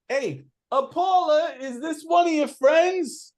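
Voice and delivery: high, loud call back into the house. Acted. Chance calling to Paula inside the house, high loud call. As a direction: shout for Paula over your shoulder. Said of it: "And he says".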